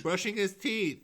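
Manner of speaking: put-on high piped kid voice; childlike chime-in. Co-host pipes up like a kid. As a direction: pipe up like an eager kid.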